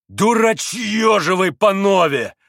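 loud, high, drawn-out outburst; blurted insult. A narrator's reading. Mitya suddenly insults the two Poles, blurting loudly. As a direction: let it burst out. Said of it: "сорвалось вдруг у Мити".

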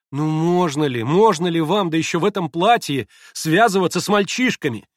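громко, с гневным упрёком; отчитывать, будто имеешь право; гневный возглас. Acted. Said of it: "гневно вскричала она". (гневно вскричав)

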